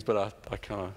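usual speaking voice

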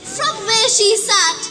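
plain reading voice

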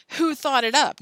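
loud, high challenge; shouted. A narrator's reading. Alan puts the blame on Joe, loud shouted challenge. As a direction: throw the blame back loudly. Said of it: "shouted Alan".

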